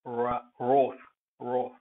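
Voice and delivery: wrath said with a long open aw vowel; pronunciation demo; model word. Shown as a demonstration. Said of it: "This is another way".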